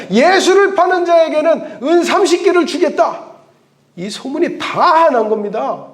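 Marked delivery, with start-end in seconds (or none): 0.1-3.3 town crier proclamation. Acted. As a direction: cry it out like a herald's notice. Rumoured bounty proclamation. (현상금을 외치는 포고 목소리)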